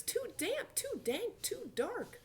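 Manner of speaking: very high little squeak; squeaky mouse. A narrator's reading. Mouse complains about the cave, high squeaky voice. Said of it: "Mouse squeaks".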